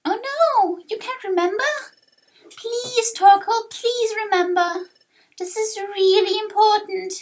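high, distressed youngster; pleading young hero. Acted. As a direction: beg urgently in a high little voice.

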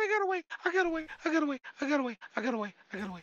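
repeated cheer, fading down; relieved triumph. Real, not acted. Repeating escape, calming down.

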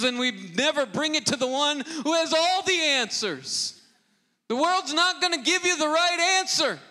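preacher urging the congregation to bring everything to God, building to full force; full-force preaching. Acted. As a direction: drive the point home to the congregation.

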